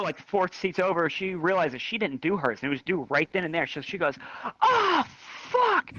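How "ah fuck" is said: high, drawn-out mock cry of dismay; mock high-pitched wail; panicked outburst. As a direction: squeal it way up high, imitating a panicked classmate. Classmate realizes project is due, acted.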